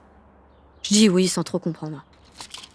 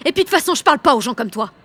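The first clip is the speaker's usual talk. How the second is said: much higher, loud furious shout; 8 semitones higher, 5 dB louder than usual. Acted. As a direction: cut her off for good, yelling. Final blowup with Vanessa. (Jeanne rompt avec Vanessa en criant)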